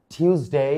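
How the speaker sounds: "টিউসডে" as "tyooz-day"; y-glide after T in "টিউসডে", long "day"; correct variant. Shown as a demonstration. Teacher recapping variants, "টিউসডে" pronounced "tyooz-day". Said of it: "এটাও সঠিক".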